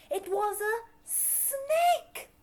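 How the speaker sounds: high, loud slow announcement; excited reveal. A narrator's reading. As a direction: burst out with the reveal. Big reveal.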